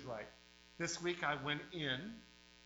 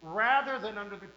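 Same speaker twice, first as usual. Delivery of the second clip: fiery pulpit preaching, hammering each line; 3 semitones higher, 4 dB louder than usual. Real, not acted. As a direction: revival preacher warning the flock. Preaching at full force.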